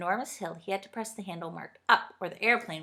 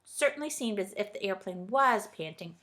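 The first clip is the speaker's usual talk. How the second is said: ordinary delivery, nothing marked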